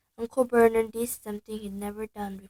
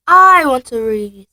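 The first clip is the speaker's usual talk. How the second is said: furious; 3 semitones higher, 20 dB louder than usual. Acted. Harry furiously demanding his own letter. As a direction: insist with fury. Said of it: "said Harry furiously".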